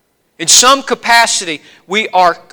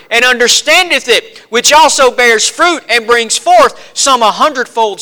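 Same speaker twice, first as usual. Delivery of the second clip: raised, ringing pulpit voice; fervent preaching; 5 semitones higher, 5 dB louder than usual. Acted. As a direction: proclaim it to the whole congregation. Preaching scripture.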